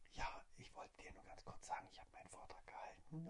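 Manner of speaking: soft secret whisper; whispering on the phone. Retold or imitated. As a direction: whisper so nobody hears. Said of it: "warum flüsterst du so?". (leises Flüstern am Telefon)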